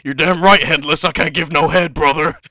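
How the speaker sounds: loud hammed-up wrestler promo; mock Hulk Hogan yelling; mock Hogan shout. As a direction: bellow it like a wrestler on the mic.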